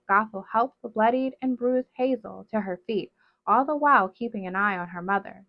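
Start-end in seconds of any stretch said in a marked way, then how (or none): none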